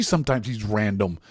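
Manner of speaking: usual speaking voice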